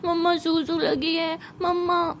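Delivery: put-on half-asleep small child calling for mamma; sleepy little cousin. Sleepwalking little cousin needs the toilet, sleepy child voice. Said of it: "नन्हा सा cousin जो है वो ऐसे नींद में".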